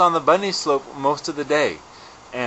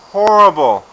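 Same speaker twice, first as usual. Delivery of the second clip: loud, higher outburst; exasperated emphasis; 7 semitones higher, 12 dB louder than usual. Real, not acted. Skier venting about a miserable run, loud exasperated emphasis. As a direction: hit each word hard in exasperation.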